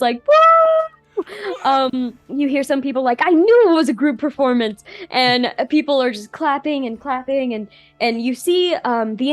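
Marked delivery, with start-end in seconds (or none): 0.2-1.2 high, loud whoop; loud cheering fan; crowd cheer. Acted. Crowd cheering after the performance. 3.2-4.9 higher, louder and quick; excited onlooker. Acted. Crowd member reacting excitedly.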